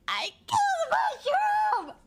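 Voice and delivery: high, drawn-out anguished scream; hysterical screaming. Retold or imitated. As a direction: re-enact screaming in sheer anguish. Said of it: "like, I'm screaming".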